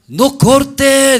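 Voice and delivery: ruego agudo y fuerte; súplica gritada. Acted. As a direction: clama desesperada para salvar al bebé. Madre suplica al rey que no corte. (la madre suplica gritando al rey)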